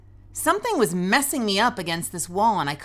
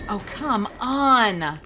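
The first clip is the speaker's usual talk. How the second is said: exasperated; 6 semitones higher, 4 dB louder, much slower than usual. Real, not acted. Frustrated at the game.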